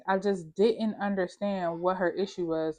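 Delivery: ordinary delivery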